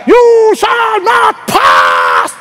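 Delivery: mock Gandalf bellow, stretched out; Gandalf impression. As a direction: roar it like the wizard on the bridge. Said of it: "sabi ni, ni Gandalf".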